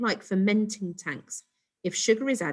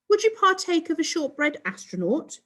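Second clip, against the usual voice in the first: high, prim alien hostess; posh alien voice; 10 semitones higher, 3 dB louder than usual. A narrator's reading. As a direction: put on Mum's posh alien voice. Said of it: "copying Mum's posh alien voice".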